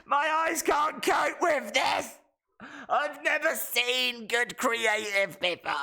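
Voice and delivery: high, shouted hammed-up wail; mock blinded wrestler. Mocking the blinded wrestler, a high hammed-up shouted wail. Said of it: "she's like".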